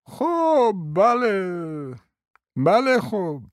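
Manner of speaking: a hint of a doddering prince; old prince. A narrator's reading. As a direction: play a rambling elderly prince. Narrator voices old prince.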